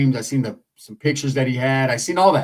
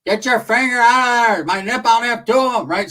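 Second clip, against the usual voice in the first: higher, louder hammed-up cry of pain; mock screaming aunt; 8 semitones higher, 4 dB louder than usual. Aunt complaining about her pinched nipple, high mock scream. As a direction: ham up the aunt's complaining. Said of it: "So she's over there screaming".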